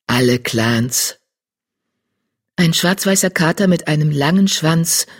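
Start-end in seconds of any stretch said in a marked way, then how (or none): 0.1-1.2 lower, slow solemn tom; deep tom voice. Acted. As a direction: lay it down solemnly. Tom cat adds gravely.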